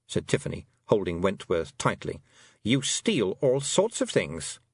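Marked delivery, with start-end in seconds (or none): none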